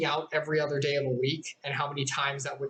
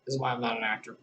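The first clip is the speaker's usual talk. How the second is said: ordinary delivery, nothing marked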